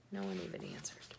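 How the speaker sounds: unvoiced whisper, deflated. A narrator's reading. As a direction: let it drop to a whisper. Dejected aside, whispered.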